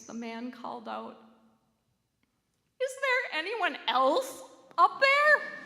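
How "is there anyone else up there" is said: high, loud, slow desperate call; terrified call. A narrator's reading. Terrified man calls up the canyon, high and loud. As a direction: call out in fright, stretching it. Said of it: "in a weak, terrified voice".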